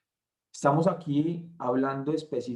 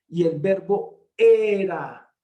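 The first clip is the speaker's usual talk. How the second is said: "era" slow and louder, falling; stress on era; 4 semitones higher, 8 dB louder, slower than usual. Shown as a demonstration. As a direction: lean on "era" to mark the past tense. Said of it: "en tiempo pasado". (énfasis en "era", lento y fuerte)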